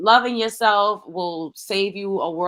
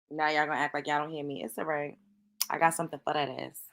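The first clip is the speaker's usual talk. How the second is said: mock threat; 5 semitones lower, 8 dB quieter, faster than usual. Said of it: "I'm joking".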